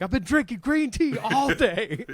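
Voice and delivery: high, unhinged movie quote; Danny McBride. Retold or imitated. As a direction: quote it like a wound-up hothead. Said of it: "like Danny McBride in".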